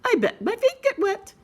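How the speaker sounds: flustered mother pig; hammed-up oh dear; high fretting mother pig. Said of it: "She said".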